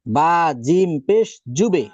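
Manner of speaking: জুবে said slowly, with the bounce ending on এ; slow teacher drill of জুবে; qalqala demo. Shown as a demonstration. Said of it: "এরপরে আমরা দেখি". (এ ধ্বনিতে ধীরে জুবে)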